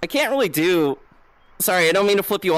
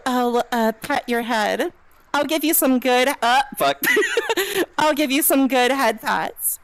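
high, cutesy soothing voice; cutesy comforting; 6 semitones higher than usual. Pretend. Streamer offering head pats to someone scared, high cutesy comforting. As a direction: coo sweetly to calm someone scared.

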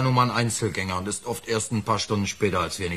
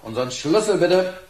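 calling loudly and slowly to the landlady; higher, louder call into the empty hall; 10 semitones higher, 5 dB louder, slower than usual. Acted. Ruft nach der Wirtin. (laut nach der Wirtin rufen)

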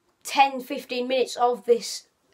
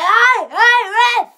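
loud, high mock-Australian shout at the ref; bad Australian accent; 10 semitones higher, 10 dB louder, much slower than usual. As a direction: call out in a hammed-up Australian accent. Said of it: "that's not a good Australian accent".

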